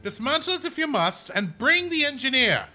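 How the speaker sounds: high, loud imperial commander barking orders; commanding officer. Acted. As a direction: order the soldiers to seize the craft.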